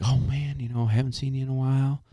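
lightly voiced Southern friend; Southern buddy greeting. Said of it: "he's like".

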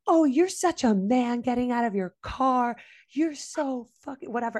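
sneering road-rage taunt at a stranger; retold sarcastic yelling. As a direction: taunt him like he's a coward. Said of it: "screaming my head off".

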